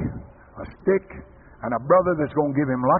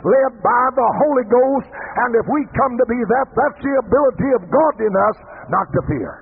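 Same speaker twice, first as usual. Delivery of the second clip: high, driving revival preacher; full-force preaching; 6 semitones higher, 4 dB louder than usual. Acted. Preacher rousing the congregation about walking on with God, full-force preaching. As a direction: preach it hard, pitched up, punching the words.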